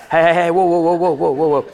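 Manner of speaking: hasty mock "whoa, whoa" to halt him; a light acted angel throwing up a stop; angel cutting in. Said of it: "That's my little interpretive in".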